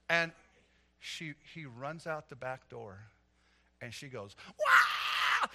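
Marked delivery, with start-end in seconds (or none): 4.6-5.5 high, loud mock scream; retold scream. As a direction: let out a sudden piercing wail. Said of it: "She just screams her head off".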